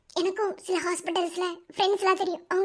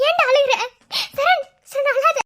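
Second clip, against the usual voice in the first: tearful comfort; 4 semitones higher, 5 dB louder than usual. Acted. Comforting crying friend.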